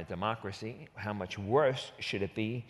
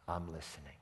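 soft, low murmured answer; a hushed "I'm listening"; quiet reply; 7 semitones lower, 12 dB quieter, faster than usual. Retold or imitated. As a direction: say it low and hushed, almost to yourself. Joab answers quietly in retelling.

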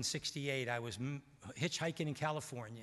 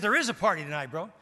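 louder and higher, playful tease; Steve's voice; 5 semitones higher, 10 dB louder, faster than usual. Retold or imitated. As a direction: bait him with a grin in the voice. Steve teasing about party.

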